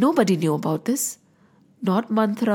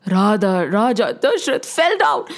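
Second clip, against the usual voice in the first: ordinary delivery, nothing marked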